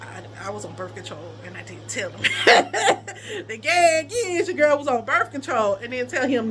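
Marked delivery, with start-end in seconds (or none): 0.0-2.2 low, soft admission; hushed confession. Real, not acted. Woman confiding to viewers about secret birth control, hushed. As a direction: drop the voice and let the secret slip.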